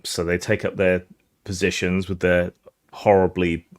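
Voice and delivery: usual speaking voice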